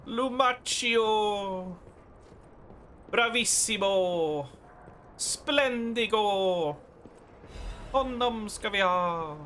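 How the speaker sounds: high, drawn out Italian cries; Italian exclamations. Pretend. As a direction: belt it like an opera host. Calling out names in mock Italian.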